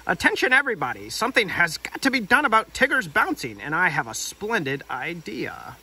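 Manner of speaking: lightly voiced fussy Rabbit; bossy Rabbit voice. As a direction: play Rabbit calling everyone to order.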